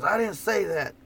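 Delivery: high, exasperated Oklahoma twang impression; fed-up friend griping in a thick Oklahoma twang. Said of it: "it has a real Oklahoma twang".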